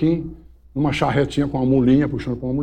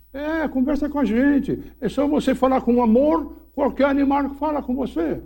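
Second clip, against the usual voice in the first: padre carinhoso; 9 semitones higher than usual. Retold or imitated. Padre explica com doçura.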